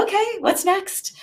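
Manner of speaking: jumping up high, ready to go; bright eager "what's next"; eager team. Retold or imitated. Sales team eager for the next step, high eager voice. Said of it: "They're like".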